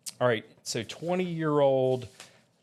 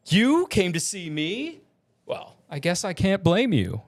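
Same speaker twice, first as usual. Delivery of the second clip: Summoner James; 5 semitones higher than usual. Acted. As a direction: stretch the question up high with a flourish.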